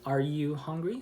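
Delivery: "hungry" swept upward at the end; rising intonation. Shown as a demonstration. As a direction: lift "hungry" into a yes-or-no question. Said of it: "So the intonation goes up".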